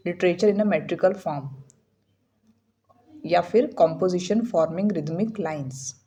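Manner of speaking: reading each word out slowly and evenly; slow definition reading. Acted. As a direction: read it out like a slow textbook dictation. Reads definition slowly aloud.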